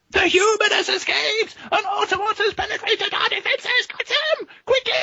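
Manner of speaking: very high-pitched robot cry of alarm; menacing robot alarm. A narrator's reading. Robot raising the alarm as prisoner escapes, menacing high screech. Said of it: "a menacing robot voice raised in alarm".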